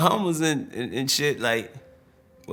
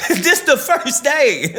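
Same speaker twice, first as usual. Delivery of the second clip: higher, louder, laughing as he calls him an idiot; laughing insult; 13 semitones higher, 4 dB louder, slower than usual. Retold or imitated. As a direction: manager Bob laughing at him over the gold placard, higher and louder. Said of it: "He said".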